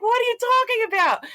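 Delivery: very high, squealed retelling; mock-panicked little boy; retold bewildered kid. Retold scared boy's reply.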